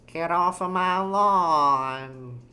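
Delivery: put-on slow, drawn-out cranky old person. Shown as a demonstration. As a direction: stretch it out like an old lawn-guarder. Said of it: "How do you do an old person?".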